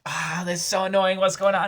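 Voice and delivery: high, put-on voice of fed-up sisters; annoyed sisters. Co-host imitates the sisters, high and fed up. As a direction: squeak it out like annoyed girls.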